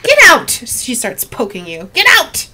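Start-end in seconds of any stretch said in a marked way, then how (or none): 0.0-0.5 loud, high crone shout; furious little old lady, pitched way up; old woman yelling. Acted. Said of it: "hear an old woman's voice". 1.9-2.5 loud, high crone shout; furious little old lady, pitched way up; old woman yelling. Acted. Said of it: "hear an old woman's voice".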